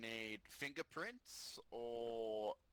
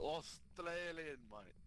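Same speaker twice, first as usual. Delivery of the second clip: mock Australian accent; 6 semitones higher, much slower than usual. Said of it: "What is that accent?".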